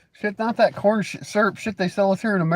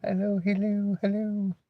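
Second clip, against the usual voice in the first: mock phone hello; 3 semitones higher, 8 dB quieter than usual.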